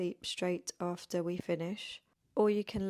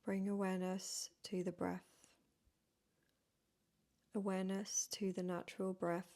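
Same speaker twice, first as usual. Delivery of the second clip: soft, unhurried yoga nidra voice with long pauses; guided meditation; 5 dB quieter than usual. Real, not acted. Yoga teacher leading beginners into rest before bed, guided meditation. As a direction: gently guide them into stillness, phrase by phrase.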